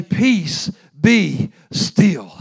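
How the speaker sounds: loud commanding peace; proclaimed. Shown as a demonstration. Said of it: "It didn't say he screamed it".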